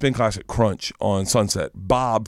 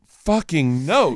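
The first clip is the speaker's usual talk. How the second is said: slow emphatic no; 5 semitones higher, much slower than usual. Retold or imitated. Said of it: "I was like".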